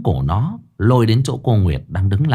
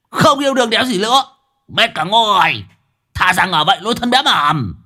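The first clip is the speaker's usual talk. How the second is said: sulky outburst; 11 semitones higher than usual. Acted. Man storms off, sulking.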